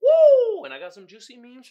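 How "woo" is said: loud, high whoop; loud, high celebratory woo; hype whoop. Real, not acted. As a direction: whoop like the crowd just went wild. Celebrating the segment.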